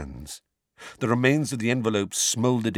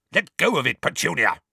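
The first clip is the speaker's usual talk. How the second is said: mock roar, higher and louder; 7 semitones higher, 4 dB louder than usual. A narrator's reading. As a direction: bellow it at your wife. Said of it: "roared Uncle Vernon".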